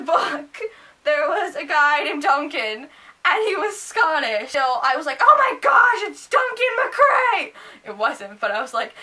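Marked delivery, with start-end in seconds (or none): 5.2-7.5 high, loud burst of recognition; reenacted shriek of delight; excited fangirl scream. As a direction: squeal it out, stretching the name. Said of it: "I was screaming".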